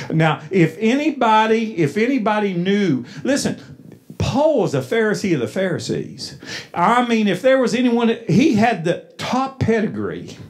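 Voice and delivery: preaching. Acted. Preacher building fervor.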